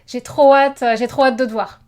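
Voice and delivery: "trop" punched loud for emphasis; stress on trop. Shown as a demonstration. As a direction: hit "trop" hard and loud. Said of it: "put some emphasis".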